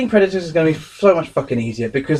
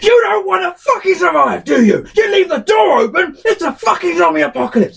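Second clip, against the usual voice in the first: screaming and shouting. Retold or imitated. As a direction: yell it like a furious housemate at a careless teammate. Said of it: "screaming and shouting".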